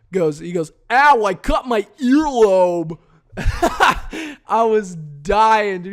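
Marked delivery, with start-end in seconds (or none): 0.9-3.0 high, loud, slow whiny yelp of pain; mock the guy wincing as he nicks his ear; hammed-up stoner ouch; imitating The Illusion. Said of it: "this motherfucker goes".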